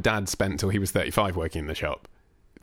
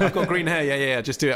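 higher, bright fake bravado; mock enthusiastic; 6 semitones higher than usual. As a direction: oversell the lie cheerfully. Playing along with the lie.

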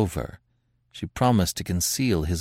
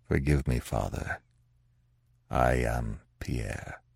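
Pierre, broken and low; 7 semitones lower, 7 dB quieter than usual. Acted.

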